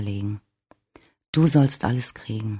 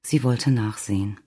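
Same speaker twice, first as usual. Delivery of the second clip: ordinary delivery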